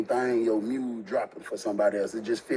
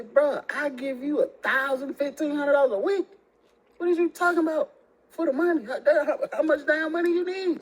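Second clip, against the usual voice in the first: higher, cracking into laughter at the absurdity; outraged disbelief; 14 semitones higher than usual. Retold or imitated. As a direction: rant in disbelief, half laughing. Outraged at her excuse.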